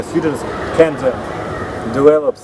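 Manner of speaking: ordinary talk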